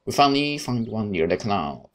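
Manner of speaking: ordinary delivery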